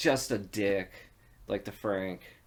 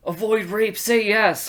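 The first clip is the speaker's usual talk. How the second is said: high, loud angry readout; imitated angry cop; 10 semitones higher, 8 dB louder, slower than usual. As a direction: read it out loud as an indignant cop. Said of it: "angry the way he said it".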